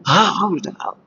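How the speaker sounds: lightly acted motherly delight; a touch of festive joy, welcoming her children. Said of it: "माँ को ऐसा उल्लास होता है". (हल्के अभिनय में माँ का उल्लास)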